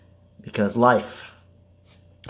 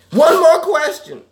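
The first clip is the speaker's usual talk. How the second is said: much higher, louder, slow silly voice; high put-on voice; 9 dB louder than usual. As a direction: play a squeaky cartoon voice.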